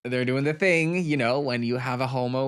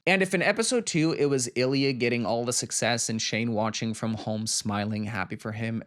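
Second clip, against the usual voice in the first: ordinary talk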